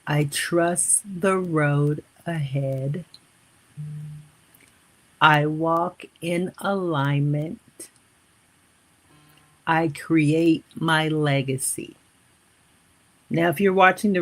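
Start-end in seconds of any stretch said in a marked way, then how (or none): 0.1-3.0 very slow, word-by-word affirmation; slowly, for dictation. Shown as a demonstration. Coach dictating affirmation for viewers to write down, slowly. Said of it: "Let me repeat this slowly". 5.2-12.0 slow, deliberate affirmation with long pauses; slowly, for dictation. Shown as a demonstration. Coach repeating so you guys can write it down, slowly. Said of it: "Let me repeat this slowly".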